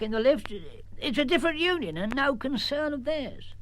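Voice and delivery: put-on slow, high old countryman voice; old uncle. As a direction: drawl it out like a superstitious old uncle. Uncle Arthur explains.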